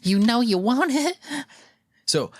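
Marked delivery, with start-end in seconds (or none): 0.0-1.2 high, slow sleazy voice; creepy raccoon voice. Retold or imitated. Voicing pervy raccoon.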